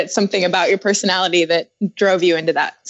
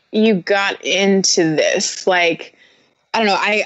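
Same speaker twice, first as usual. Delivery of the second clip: normal talking voice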